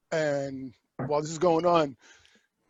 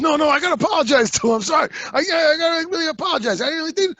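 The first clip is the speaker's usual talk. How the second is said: squeaky panicked apology, high and fast; high frantic pleading; 11 semitones higher, 5 dB louder, faster than usual. Retold or imitated. Retelling the neighbor begging Art, high panicked plea. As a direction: beg like a cornered weirdo, voice jumping up.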